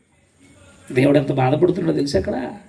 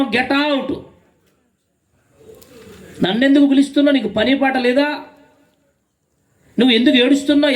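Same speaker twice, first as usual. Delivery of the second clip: high-pitched, insistent preacher's scolding; acted stern rebuke; 7 semitones higher than usual. Preacher as God sending callers away, stern rebuke.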